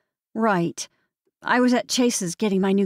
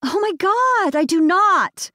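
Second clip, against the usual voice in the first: much higher, loud indignant cry; shocked denial; 11 semitones higher, 6 dB louder, slower than usual. Acted. Samantha denies the boyfriend to her mother, shocked. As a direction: protest in horrified disbelief.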